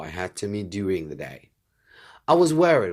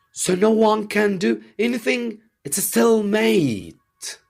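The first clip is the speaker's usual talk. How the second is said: dismayed cry; 14 semitones higher, 5 dB louder than usual. A narrator's reading. Fritz cries out at the hopeless stalemate. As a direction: wail at the deadlock. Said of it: "cried Fritz".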